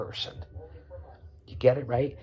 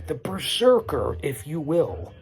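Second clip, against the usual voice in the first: louder, higher, slow hype; expressive flourish; 3 semitones higher, 6 dB louder, slower than usual. Real, not acted. Podcaster hyping his wrestler comparison, loud and slow. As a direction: belt it out like a ring announcer. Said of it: "my little expressive".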